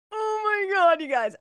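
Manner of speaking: high, loud burst of delight; suddenly overwhelmed with joy; excited gasp. Real, not acted. Telling friends about the kitten, loud and high with delight.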